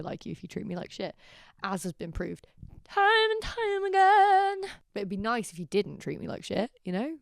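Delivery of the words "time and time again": high, loud, drawn-out words; slow sing-song lament, hammed up; mock sing-song. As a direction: stretch it out high for comic effect. Comic aside on being mistreated.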